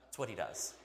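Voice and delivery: ordinary delivery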